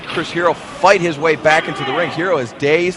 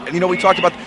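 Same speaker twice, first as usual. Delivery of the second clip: normal talking voice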